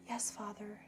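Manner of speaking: soft breathy, airy yes. Shown as a demonstration. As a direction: breathe the words out softly. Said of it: "in a more breathy voice".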